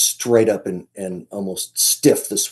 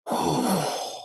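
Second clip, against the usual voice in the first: long unvoiced animal exhale; big exhale; 5 semitones lower, 6 dB quieter than usual. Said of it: "a big exhale".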